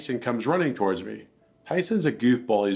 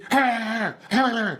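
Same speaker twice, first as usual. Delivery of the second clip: high nervous laugh; 13 semitones higher than usual. Real, not acted. Laugh at insane aggression.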